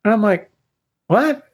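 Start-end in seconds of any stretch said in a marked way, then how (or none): 1.1-1.5 baffled what. Retold or imitated. Confused reply to son.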